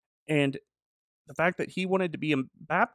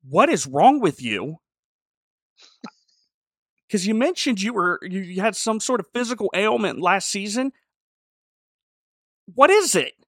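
higher and louder, building disbelief; exasperated rant; 7 semitones higher, 6 dB louder than usual. Retold or imitated. Host ranting at little James about his ailment, exasperated and louder. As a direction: demand an answer, incredulous.

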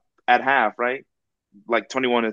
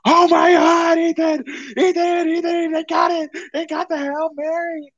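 excited screaming. Retold or imitated. Yelling to friend Ethan on the phone about the Hail Mary, screaming. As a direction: scream the news like the game just ended. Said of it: "but I'm screaming".

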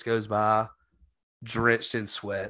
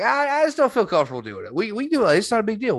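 quoting himself; 9 semitones higher, faster than usual. Retold or imitated. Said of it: "And I'm like".

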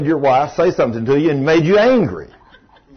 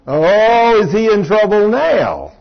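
ordinary talk

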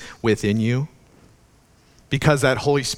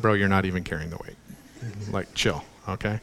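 casual dude God; 4 semitones lower, 6 dB quieter than usual. Acted. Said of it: "he's like".

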